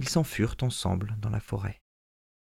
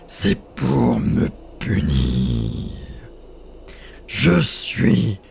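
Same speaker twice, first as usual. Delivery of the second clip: roaring giant spirit; 6 dB louder, slower than usual. Acted. Spirit explaining his punishment, louder and booming. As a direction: boom it out like a vengeful giant.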